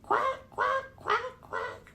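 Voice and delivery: high, squeaky duckling quacks; little ducklings quacking together. A narrator's reading. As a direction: quack like a row of tiny ducklings. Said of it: "went Jack, Cat, Quack".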